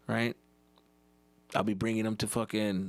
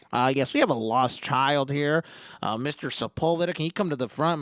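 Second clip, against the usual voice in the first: page a parent over store speakers; loudspeaker announcement; 5 dB louder than usual. Retold or imitated.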